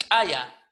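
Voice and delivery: "kaya" with a popped ejective K; struck K. Shown as a demonstration. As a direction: click the K of "kaya" hard, no breath. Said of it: "o golpeado".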